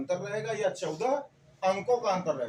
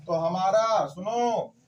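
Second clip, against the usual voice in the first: louder, slow, commanding the class; loud emphasis; 2 semitones higher, 6 dB louder, slower than usual. Real, not acted. Teacher calling the class to attention about the first alkene member, loud and slow. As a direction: make the room listen.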